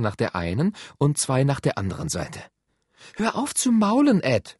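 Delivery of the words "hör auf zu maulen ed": high-pitched sisterly telling-off; sharply raised pitch, reproving; scolding. A narrator's reading. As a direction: scold a moaning brother. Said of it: "schalt Sus".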